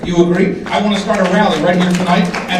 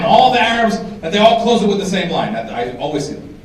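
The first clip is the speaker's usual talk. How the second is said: normal talking voice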